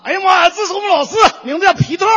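hollered Dongbei accent, hit 特 hard; Northeastern accent. Shown as a demonstration. Imitating a Northeastern classmate hollering the introduction, loud Dongbei accent. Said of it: "东北人啊".

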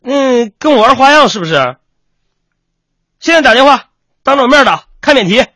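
high, loud ordering; angry teacher. Acted. Teacher making pupil phone mother right now, angry.

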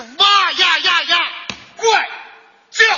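loud, high war cry; wild battle roar. Acted. Storyteller voices Zhang Fei's battle roar, loud and wild. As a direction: roar like the general Zhang Fei.

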